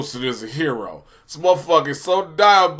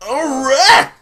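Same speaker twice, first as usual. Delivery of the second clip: loud exclamation; 10 semitones higher, 9 dB louder than usual. Real, not acted. Sudden exclamation.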